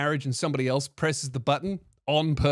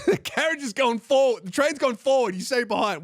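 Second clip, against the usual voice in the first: high-pitched, hammed-up scolding; mock exasperated correction; 8 semitones higher than usual. Scolding the muddled caller about directions, mock exasperation. Re-enacts being corrected as idiot.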